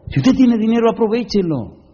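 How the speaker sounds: sudden high-pitched urging; urgent exhortation. Real, not acted. Preacher urges congregation to use their money, insistent. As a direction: call it out eagerly, falling at the end.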